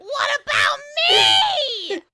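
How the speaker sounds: very high, loud, drawn-out screech; screamed tantrum. Retold or imitated. Lube left behind while Fred is helped, screaming. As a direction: screech it slowly like a child demanding attention.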